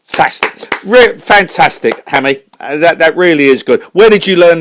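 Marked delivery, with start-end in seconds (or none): none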